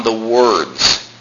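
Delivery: WORDS set apart at the end; emphatic "words"; stress on WORDS. Shown as a demonstration. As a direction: lean on "words" as the key term. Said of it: "notice the emphasis that God always puts".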